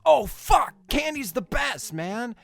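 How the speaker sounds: high, loud delighted shout; excited kid. Retold or imitated. Young Wonka finds candy, excited shout. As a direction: gush over the candy. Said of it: "He's like".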